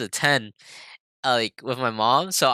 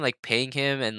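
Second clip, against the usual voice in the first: plain reading voice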